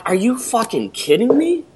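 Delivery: incredulous but held down in volume; retold disbelief. Listener reacting to a radio ad, incredulous.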